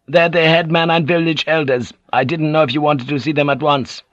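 lightly voiced retainer reporting to his master; Buntaro voice. A narrator's reading. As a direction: report dutifully to your lord.

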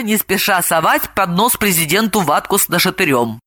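ordinary delivery, nothing marked

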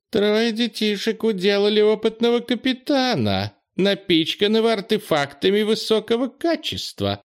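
very high, squeaky little voice held on one note; approving squeak. A narrator's reading. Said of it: "с одобрением пропищал Урхул". (одобрительный тонкий писк)

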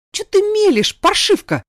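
very high, loud interrupting shout; angry yell. A narrator's reading. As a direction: cut her off with an outraged yell. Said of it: "перебив, заорала Ирада". (гневный крик)

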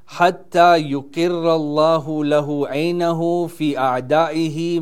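fast, chanted Arabic recital with rising emphasis; hadith recitation. Acted. Preacher citing the Imam to the congregation, swift chanted recital. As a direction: recite the saying swiftly, building in intensity.

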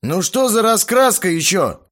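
high, indignant complaining voice; offended whine. A narrator's reading. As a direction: complain like a sulky teen. Боба offended, fists clenched.

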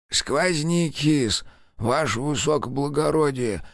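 put-on slow, high, far-off old man's mumble; mumbling old clerk. A narrator's reading. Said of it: "прошамкал старик".

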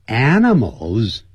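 "animals" slow, with an open a at the start; careful "animals". Shown as a demonstration. Teacher modelling "animals" with an open a. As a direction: stretch the first vowel of "animals". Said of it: "发啊的音".

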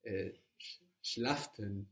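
"schlafen" said as "schlaften" with an added t; soft mock "schlaften" with a slip; imitating an extra t. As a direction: mimic a learner who adds a t. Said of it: "put in extra sounds".

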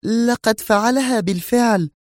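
high female voice, tense and hushed; tense woman's mutter. Acted. Zosha murmuring nervously to Yuri, high voice. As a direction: voice Zosha under strain. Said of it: "زفرت زوشا في توتر وهي تغمغم".

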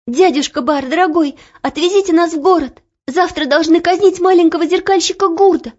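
put-on high, hurried girlish pleading; rapid urgent plea. A narrator's reading. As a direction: rush it out, begging. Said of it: "быстро говорила Оля".

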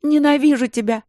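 high, loud scream of hatred; furious yelling. A narrator's reading. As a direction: scream it at her. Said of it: "заорала младшая сестра".

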